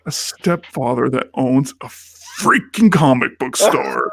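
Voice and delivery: slow, deliberate retold declaration; grand proclamation. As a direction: proclaim it word by word, savoring the dream. Proclaims his hopes on the ride.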